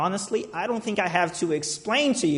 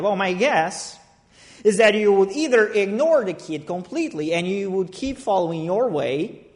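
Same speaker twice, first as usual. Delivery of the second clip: ordinary talk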